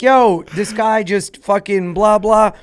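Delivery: high, loud, slow complaint to police; retold complaint. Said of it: "I'm like".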